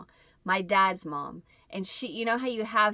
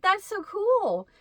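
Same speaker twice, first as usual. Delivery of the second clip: fake cheerful; 11 semitones higher, 3 dB louder, slower than usual. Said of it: "But I was like".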